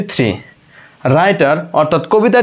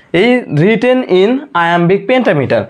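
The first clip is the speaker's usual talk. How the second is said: each word drawn out slowly for students to copy; slow dictation; 2 semitones higher, much slower than usual. Shown as a demonstration. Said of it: "দেখেন কি বলেছে".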